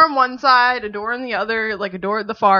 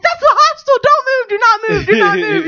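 much higher, louder frantic orders; screaming; 11 semitones higher, 6 dB louder than usual. Retold or imitated. Friend Erica ordering her to stay put, screaming down the phone. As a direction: yell down the phone in a panic. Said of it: "screaming at me over the phone".

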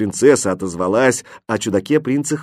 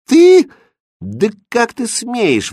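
high, loud burst, then slow indignation; outraged exclamation; 12 semitones higher, 5 dB louder, slower than usual. A narrator's reading. Princess is affronted by his proposal, exclaiming in outrage. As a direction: explode on the first word, then draw out the affront. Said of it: "воскликнула принцесса".

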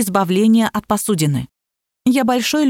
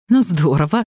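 ordinary delivery, nothing marked

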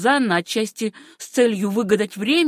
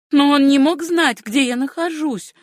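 put-on higher-pitched girl's voice; protesting young woman; 6 semitones higher, 4 dB louder than usual. A narrator's reading. Niece defending herself to her uncle, high-voiced protest. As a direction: plead innocence in a raised, high voice.

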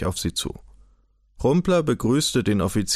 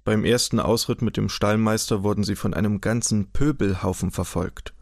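ordinary delivery

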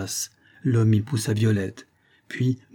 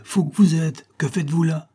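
higher, urgent scolding question; crying out; 6 semitones higher than usual. A narrator's reading. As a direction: cry out at a reckless fool. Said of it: "me cria-t-il".

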